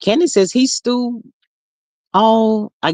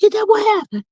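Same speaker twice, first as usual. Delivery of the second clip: much higher, puzzled; confused surprise; 13 semitones higher than usual. Real, not acted. Asking a viewer who she means, puzzled. Reacting to gossip.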